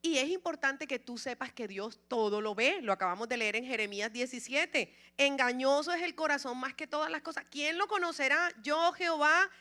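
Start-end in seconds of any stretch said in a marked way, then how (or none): 5.2-9.5 higher, louder solemn proclamation; scripture reading. Acted. Preacher declaiming Jeremiah to the congregation, loud and solemn. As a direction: proclaim God's words over the church.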